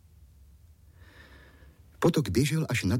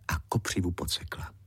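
ordinary delivery, nothing marked

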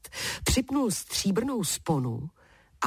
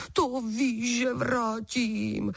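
higher, drawn-out giant's promise; eager giant, slow and raised; promising giant; 6 semitones higher, much slower than usual. A narrator's reading. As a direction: promise readily, stretching the last word. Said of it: "slíbil obr".

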